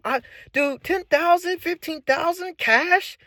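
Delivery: a man who grew up broke stunned by fifteen thousand cash, high and amazed; amazed disbelief. Real, not acted. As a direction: pitch jumps way up and drags out fifteen thousand cash in wonder.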